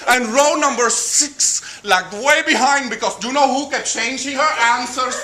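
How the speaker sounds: put-on high Spanish-accented teacher running a contest; Mrs. Martinez the teacher.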